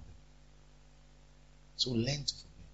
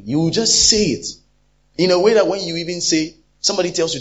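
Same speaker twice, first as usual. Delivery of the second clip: louder, emphatic preaching; 4 semitones higher, 8 dB louder than usual. Real, not acted. Preacher telling the congregation how anger talks, forceful. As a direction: press the point hard. Describing angry speech.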